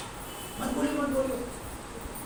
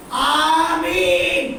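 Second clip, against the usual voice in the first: long drawn-out amen, louder; amen from the gut; 4 semitones higher, 6 dB louder, much slower than usual. Shown as a demonstration. Pastor demonstrates a heartfelt amen, drawn out. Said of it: "आवाज निकलती है".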